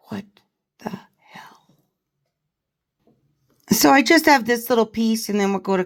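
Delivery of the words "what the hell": stunned whisper. Real, not acted. Host reacting to the butcher knives story, stunned whisper. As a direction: whisper it in disbelief, word by word.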